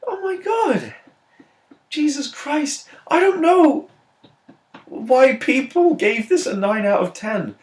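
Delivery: very high falsetto whine; squeaky, hammed-up dismay; exasperated despair. Real, not acted. Despairing at film's high ratings.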